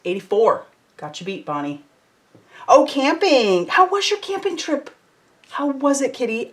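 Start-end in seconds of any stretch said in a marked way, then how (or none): 2.7-6.5 high eager how was camping; excited question. Real, not acted. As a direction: perk right up with interest. Asking a viewer about her trip.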